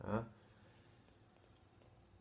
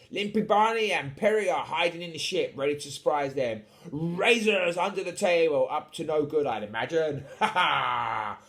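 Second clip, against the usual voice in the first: animated swashbuckler with a loud, rolling laugh; hearty pirate captain; 4 semitones higher, 7 dB louder than usual. Acted. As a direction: play a jolly, scheming pirate captain. Voices Captain Eight Piece.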